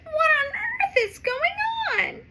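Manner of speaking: anxious cry of concern; worried. Acted.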